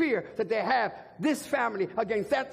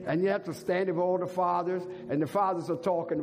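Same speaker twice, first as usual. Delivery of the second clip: ordinary talk, nothing marked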